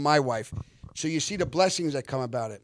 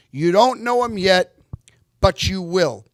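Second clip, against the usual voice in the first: loud, raised proclamation, imitated; 4 semitones higher, 11 dB louder than usual. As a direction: announce a newcomer with big emphasis. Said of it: "So he goes".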